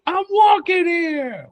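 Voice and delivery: high, slow, hammed-up New York yell; Ratso Rizzo impression. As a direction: shout it like a cabbie nearly hit you. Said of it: "What's the movie?".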